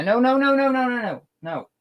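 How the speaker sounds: high, sing-song no's at the cat; high pet scolding. Real, not acted. Streamer to cat on the desk, high sing-song scolding.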